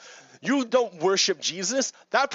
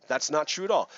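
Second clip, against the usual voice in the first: ordinary delivery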